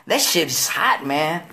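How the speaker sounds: ordinary talk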